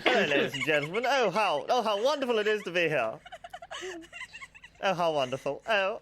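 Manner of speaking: put-on fast, laughing grand accent; hammed-up posh accent, laughing through it. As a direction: greet them like a pompous aristocrat. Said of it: "There's no need for the accent".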